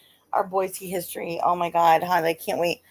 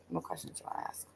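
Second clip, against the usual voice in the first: whispered aside; 18 dB quieter, faster than usual. Real, not acted. Puzzled aside to self.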